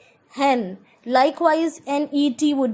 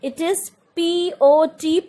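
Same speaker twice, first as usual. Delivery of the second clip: ordinary talk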